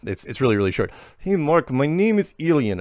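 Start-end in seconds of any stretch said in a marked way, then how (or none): 1.2-2.8 higher, louder put-on Russian accent; terrible Russian accent. Host reading a short listener email from Ilian, terrible Russian accent. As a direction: ham up a bad Russian accent. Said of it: "I got to do my accent".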